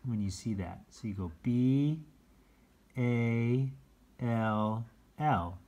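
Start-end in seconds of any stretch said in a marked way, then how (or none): 1.4-5.6 letter by letter, drawn out; slow spelling for a child; spelled slowly. Shown as a demonstration. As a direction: space out each letter. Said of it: "take your time spelling it".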